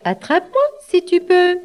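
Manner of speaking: high, quick sing-song taunt; playful catch-me chant; taunting galette. A narrator's reading. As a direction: tease them in a high little voice. Galette taunts rabbit, then flees.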